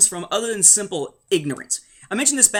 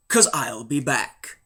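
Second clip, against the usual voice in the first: low, slow action-hero line; Terminator-esque; 5 semitones lower, slower than usual. Retold or imitated. Smuggler answering Leia before leaving, Terminator-style delivery. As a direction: drop low and slow on the catchphrase. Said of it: "Terminator-esque there".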